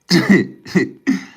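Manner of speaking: short, high loud laugh; real laughter. Real, not acted. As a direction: burst out laughing in quick bursts. Cracks up at sudden sight.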